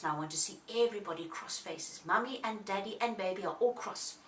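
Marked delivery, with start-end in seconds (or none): none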